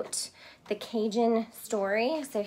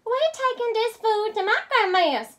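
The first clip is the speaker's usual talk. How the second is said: put-on high, bright Cajun girl; little girl voice; 12 semitones higher, 5 dB louder than usual. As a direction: answer the gator like a brave little girl. Girl answers gator.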